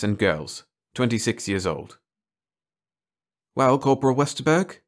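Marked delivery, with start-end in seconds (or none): 3.5-4.8 higher, louder prodding repeat; doctor repeating. A narrator's reading. Doctor presses the shy corporal, higher and louder. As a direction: press him with the question. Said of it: "Doctor Harris said again".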